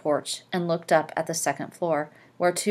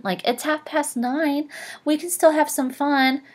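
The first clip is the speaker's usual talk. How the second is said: mock her airy line in a sing-song; mocking Jordan; 9 semitones higher than usual. Teacher mocks Jordan's line.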